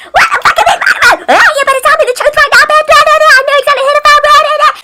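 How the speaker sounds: fast, loud high-pitched phone scream. Retold or imitated. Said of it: "I've never screamed so loud in my life".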